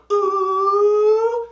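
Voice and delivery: put-on high drawn-out kids' "ooh"; mock crowd "ooh". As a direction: imitate classmates egging on a fight. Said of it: "you know how they all go".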